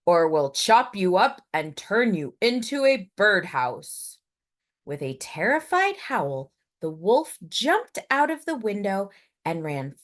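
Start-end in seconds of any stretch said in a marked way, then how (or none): 0.0-4.2 louder gruff threat; woodcutter's bellow. Acted. The woodcutter threatening the wolf, loud. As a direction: threaten him loudly. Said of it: "bellowed the woodcutter".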